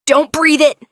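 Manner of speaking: loud high sharp order; command. A narrator's reading. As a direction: command them to cover up. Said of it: "Elias commanded".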